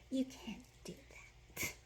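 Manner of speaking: slow teasing whisper. Pretend. Teasing the shark eaters in a slow whisper. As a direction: whisper the warning slowly.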